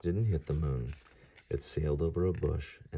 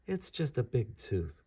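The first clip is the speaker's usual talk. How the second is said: slow, higher matter-of-fact voice; young god; 7 semitones higher than usual. Acted. Ganesha answering Vyasa, slow higher voice. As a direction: point it out like a cheeky boy. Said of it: "Ganesha said".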